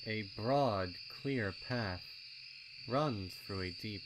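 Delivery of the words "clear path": usual speaking voice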